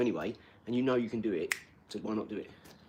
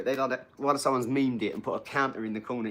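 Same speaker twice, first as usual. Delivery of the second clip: ordinary talk, nothing marked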